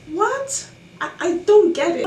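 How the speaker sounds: high-pitched baffled "what?"; incredulous. Real, not acted. Baffled reaction to what people say.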